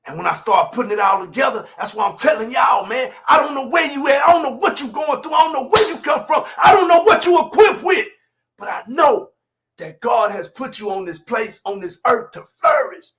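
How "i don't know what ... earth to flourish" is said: normal talking voice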